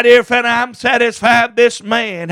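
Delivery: ordinary talk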